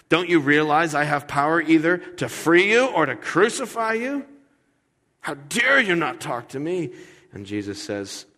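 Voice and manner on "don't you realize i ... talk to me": higher, louder indignant demand; angry Pilate. Retold or imitated. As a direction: rise up in offended anger. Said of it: "gets his Irish up".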